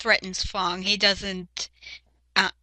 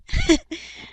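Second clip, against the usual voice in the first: high, strange evil cackle; sudden high villain laugh. Retold or imitated. Acting out villain Sever's intro.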